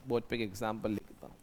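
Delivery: ordinary talk, nothing marked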